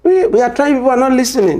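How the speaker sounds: high, loud mock complaint; exasperated protest. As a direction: throw up your hands, pleading.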